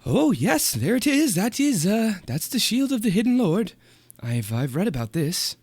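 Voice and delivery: high excited bookish voice; nerdy scholar voice. Acted. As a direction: gush like a delighted scholar. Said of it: "and he says".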